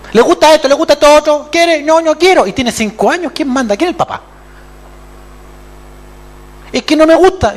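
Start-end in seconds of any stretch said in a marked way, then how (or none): 0.1-2.5 put-on high, loud pleading with a child; mock coaxing parent. 6.7-7.5 high, loud whining refusal; whiny child. Acted.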